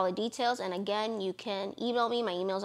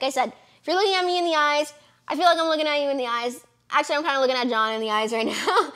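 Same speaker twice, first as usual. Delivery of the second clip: quicker, higher and louder, rushing on; bubbling over while talking straight to camera; excited; 5 semitones higher, 5 dB louder, much faster than usual. Real, not acted. Vlogger speaking straight into camera to viewers, excited and fast.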